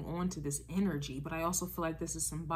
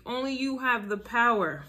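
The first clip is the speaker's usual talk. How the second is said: louder, higher line landing on "power"; emphatic on power; 5 semitones higher, 5 dB louder than usual. Real, not acted. Tarot reader repeating Scorpio's power, emphatic. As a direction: drive it home to the word "power". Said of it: "Emphasis on the word power".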